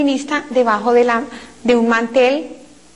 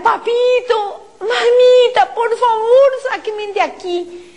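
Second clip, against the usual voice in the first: high tearful cry, fading at the end; crying plea to parents; 9 semitones higher, slower than usual. Retold or imitated. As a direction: wail to her parents for rescue. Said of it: "Yo le empecé a gritar".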